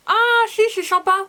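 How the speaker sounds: a real happy little surprise at the taste; sudden delight after expecting nothing; pleasantly surprised. Real, not acted. Tasting, finds flavor pleasant.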